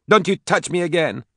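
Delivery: high, sharp shout. Acted. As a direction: keep her away with a shout. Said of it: "Katie shouted".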